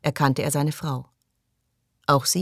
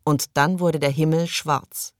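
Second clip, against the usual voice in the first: usual speaking voice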